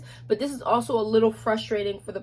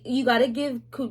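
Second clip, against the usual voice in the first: ordinary talk, nothing marked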